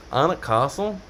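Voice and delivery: Anik Castle with British broad "ah" vowels, rising as a question; British accent, long open A in both "Anik" and "Castle"; British-accented place name. Shown as a demonstration. Retries name in British-style pronunciation.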